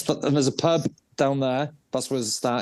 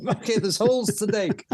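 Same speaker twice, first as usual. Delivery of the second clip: high, jokey put-on voice; chirpy undertaker ready to dig; mock undertaker; 10 semitones higher than usual. Same imagined undertaker voice.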